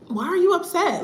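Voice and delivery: high, incredulous question; baffled challenge. Retold or imitated. Traveler to clerk who was already upset, baffled. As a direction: put it to them in high-pitched bafflement. Retelling her confrontation with the clerk.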